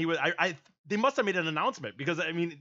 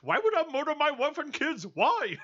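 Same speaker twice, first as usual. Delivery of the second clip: put-on half-hearted Indian accent; 9 semitones higher than usual. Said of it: "I can't do the Indian accent".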